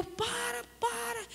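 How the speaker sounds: high, drawn plea at modest volume; mock a desperate yell without the volume; grito imitado. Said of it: "Eu gritava". (grito agudo e contido, imitado)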